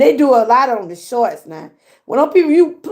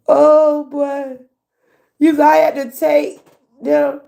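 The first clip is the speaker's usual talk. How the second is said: usual speaking voice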